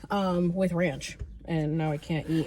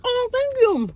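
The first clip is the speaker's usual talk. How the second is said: very high, louder, gushing imitation; imitated grateful man; 6 dB louder, slower than usual. Restaurant man thanking her with a hug, high gushing imitation. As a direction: squeal his thanks in mimicry. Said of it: "He's like".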